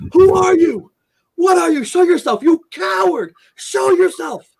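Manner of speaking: high, loud yelling at the unseen attacker; retold scream. Man in the shower shouting at an invisible attacker, loud high yell. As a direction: shout it out, terrified and defiant. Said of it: "And I screamed".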